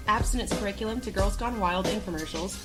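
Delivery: usual speaking voice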